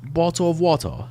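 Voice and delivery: high, botched British attempt; bad British accent. Shown as a demonstration. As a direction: overdo a British accent and get it wrong. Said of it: "in a British accent".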